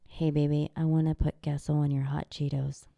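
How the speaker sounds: low, mock-seductive ASMR; deep, teasing come-on; funny ASMR. Shown as a demonstration. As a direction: purr it low as a joke. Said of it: "funny A S M R".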